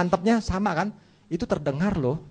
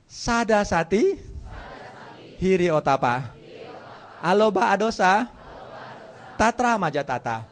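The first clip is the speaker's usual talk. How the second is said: call-out recitation. Shown as a demonstration. Teacher leads students repeating memorised list, call-out recitation. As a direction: call each term out for the class to repeat.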